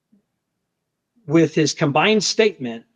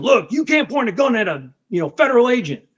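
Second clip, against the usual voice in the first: drill sergeant voice; 8 semitones higher than usual. Retold or imitated. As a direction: bark it like a drill instructor. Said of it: "I had my drill sergeant voice out".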